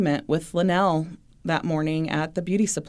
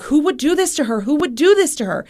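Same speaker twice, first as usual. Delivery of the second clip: imitated distraught plea, high and raised; retold sobbing; 9 semitones higher, 4 dB louder than usual. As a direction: voice lifted in reenacted anguish. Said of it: "He immediately begins sobbing".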